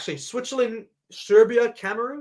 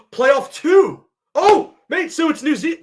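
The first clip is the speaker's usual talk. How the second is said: surprised gasp; 7 semitones higher, 8 dB louder, slower than usual. Real, not acted. Reacting live to draw result.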